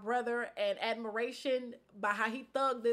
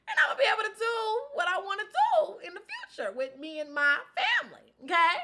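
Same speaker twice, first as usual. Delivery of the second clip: laughing through tears, much higher and louder; tearful laughing vow; 9 semitones higher, 4 dB louder than usual. Real, not acted. Woman vowing to her viewers she'll still have a family. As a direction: push the promise out between laughs and tears.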